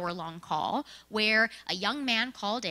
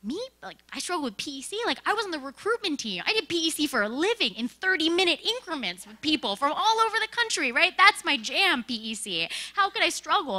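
higher and louder, protesting fast; a mock-outraged defence of her skills; indignant disbelief; 7 semitones higher, 5 dB louder than usual. Speaker retelling how she protested to her reviewer, high, loud and indignant. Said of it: "And I'm like".

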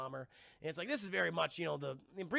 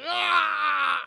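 long, high, loud scream; hammed-up monster battle yell; charging roar; 10 semitones higher, 13 dB louder than usual. Imitating ape's roar.

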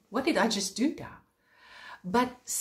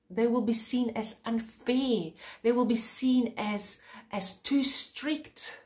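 usual speaking voice

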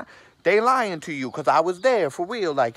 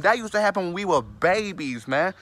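ordinary talk

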